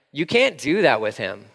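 ordinary talk

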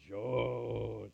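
low, soft, drawn-out name; lightly voiced Anna, lingering on the name; Anna calling Joseph. Anna greets young Joseph at first sight, low and drawn out. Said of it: "she said".

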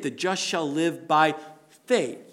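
The just shall live by... pause, then "faith." landed as the key word; stress on faith. Shown as a demonstration. Pastor showing Romans' emphasis, stress on "faith.". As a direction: hold back, then land on "faith.". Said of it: "with an emphasis on faith".

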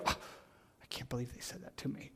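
gasped, hushed shock; hurt disbelief. Acted. Someone stung by a rebuke, whispering in mock hurt. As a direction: whisper it fast, as if wounded. Acting offended reaction to correction.

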